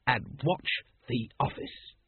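slow, spaced-out words of an order; slow, weighty briefing to a junior; deliberate instruction. A narrator's reading. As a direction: spell out the duty grandly and slowly. Captain humours the keen recruit.

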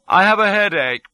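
high, put-on princely voice. A narrator's reading. Impostor prince tests the doctor, a high put-on voice. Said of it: "says I, quite gently".

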